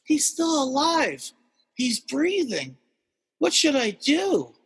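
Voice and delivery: a scared guy asking for help; panicked neighbor. Retold or imitated. Neighbor over the raccoon, slow and anxious. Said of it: "He's like".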